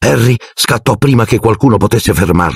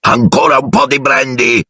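higher, panicked shout; shrieking uncle; 7 semitones higher than usual. A narrator's reading. Uncle Vernon shrieks to stop the quarrel. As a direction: cry out to change the subject fast. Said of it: "strillò zio Vernon".

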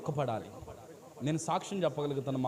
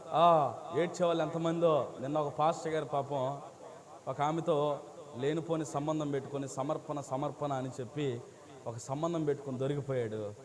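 ordinary talk

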